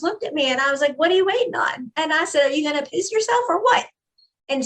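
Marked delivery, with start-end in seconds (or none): 1.0-1.9 higher-pitched mocking dare; retold goading. Retelling how she goaded him. 2.5-3.9 higher-pitched mocking dare; retold goading. Retelling how she goaded him.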